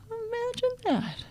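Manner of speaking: very high, playful mock amazement; mock wonder. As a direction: feign wonder in a high little voice. Her mock-amazed reply to students.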